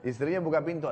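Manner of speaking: ordinary talk, nothing marked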